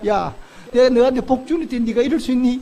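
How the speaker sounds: as if God finally told us off; scolding God. Pretend. God rebuking ungrateful people, a mock scolding. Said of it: "하나님 한 번도 우리 보고".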